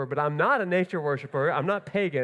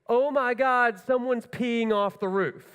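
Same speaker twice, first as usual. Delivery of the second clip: a startled cry, higher and louder; alarmed shout; 6 semitones higher, 4 dB louder than usual. Retold or imitated. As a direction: yell it like you just spotted something on the roof. Kid crying out from below.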